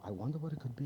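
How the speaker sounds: low, soft, wondering to yourself; soft puzzled murmur, pitched low; puzzled to himself. Pretend. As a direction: murmur it as if alone.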